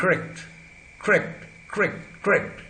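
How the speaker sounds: "करेक्ट" as one-syllable "krect" with a gliding r; fuse k and untapped r in "करेक्ट", repeated; approximant r. Shown as a demonstration. Teacher showing the changed r, approximant pronunciation. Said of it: "alveolar र को हमने अप्रॉक्सीमेट में बदल दिया".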